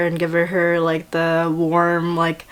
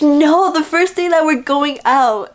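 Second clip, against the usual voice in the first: higher, louder no, correcting him; exasperated correction; 7 semitones higher, 4 dB louder than usual. Retold or imitated. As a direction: set the boyfriend straight, half laughing. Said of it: "She's like".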